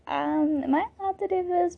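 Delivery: sheepish high question. Retold or imitated. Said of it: "I was like".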